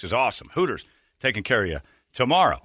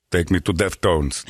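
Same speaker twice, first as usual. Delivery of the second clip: [name] impression; 5 semitones lower, slower than usual. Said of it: "do the [name] line".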